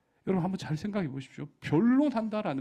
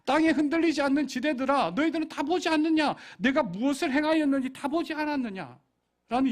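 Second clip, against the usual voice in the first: raised, urgent divine plea; God pleading with the land, raised and urgent; 8 semitones higher, 5 dB louder than usual. Acted. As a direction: call out to the land as a wounded God. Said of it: "이야기예요".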